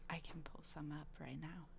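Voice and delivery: soft unvoiced whisper. Real, not acted. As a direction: murmur it under your breath. Whispered aside.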